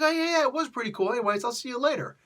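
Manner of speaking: very high-pitched put-on hero voice; voice-straining falsetto. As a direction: strain way up high, imitating a chummy reply. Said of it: "about to lose my voice now".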